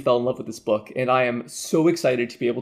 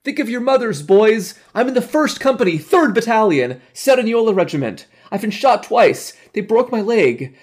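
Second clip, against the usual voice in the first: crying out; 10 semitones higher, 7 dB louder than usual. A narrator's reading. Abandoned wounded soldier to his comrades, crying out. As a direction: cry out desperately to be heard. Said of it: "and cried out".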